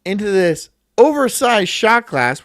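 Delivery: high and loud, racing; hyped up. Real, not acted. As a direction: crow about the pour. Hyping up pouring a shot.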